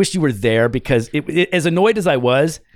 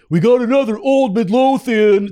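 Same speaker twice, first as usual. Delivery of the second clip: mock cop call; 10 semitones higher, 5 dB louder, slower than usual. Cop calls to other cop.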